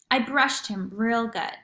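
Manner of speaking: usual speaking voice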